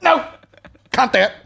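very high mock whine; mock her refusal; mocking sister.

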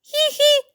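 very high tiny giggle; a squeaky little giggle. Acted. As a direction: snowflake giggling at the hedgehog. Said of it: "засміялася сніжинка".

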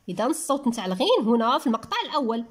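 ordinary delivery

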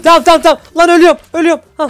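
frantic, loud and high; panicked yelling. Real, not acted. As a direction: yell that you're dying. Dying in game, panicking. (panik içinde bağırma)

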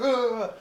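high, loud mock groan; imitated groan. Gunner kicked in the head, loud mock groan. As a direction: mimic the yelp of someone kicked.

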